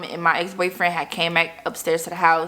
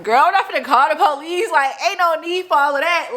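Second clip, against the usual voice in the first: high, dismissive; 8 semitones higher than usual. Retold or imitated. Dismissing the idea of police.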